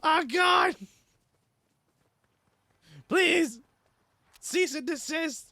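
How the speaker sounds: high, loud panicked yelling; panicked shout. Real, not acted. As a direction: scream for mercy. Being chased in game, shouting.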